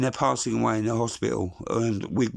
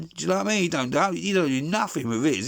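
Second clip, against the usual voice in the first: normal talking voice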